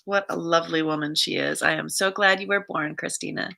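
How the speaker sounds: ordinary delivery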